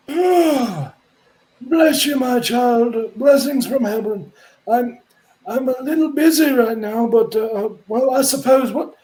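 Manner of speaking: high, pious priest voice; a fussy holy man, busy but polite. Acted. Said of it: "he stops and goes".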